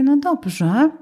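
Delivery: high, drawn-out huffy assent; mock sulk. Friend refused a loan, sulkily agreeing. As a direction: give in while clearly taking offence. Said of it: "Foszek taki".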